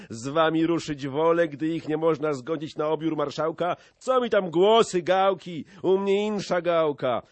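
loud, high, rowdy declaration; heated brawler's boast; defiant pledge. A narrator's reading. Brawler defiantly joins the fight.

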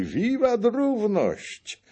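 cheering shout. A narrator's reading. The three families shout for equality, a slow high cheer. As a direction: cheer as a group. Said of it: "krzyknęli".

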